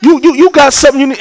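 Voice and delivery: put-on high-pitched challenge to his young son; indignant retold dad; serious confrontation. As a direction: square up to a child in mock earnest. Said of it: "And I was serious".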